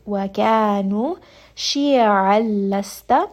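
Wakanu with two-count vowels, said slowly; slow demo. Shown as a demonstration. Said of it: "two count mad". (slow recitation)

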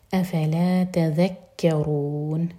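very slow, with the end of تذکرون held long; lengthened ending. Shown as a demonstration. Teacher modelling the stretched ending for students, slow. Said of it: "اینڈ میں مد عارض للسکون".

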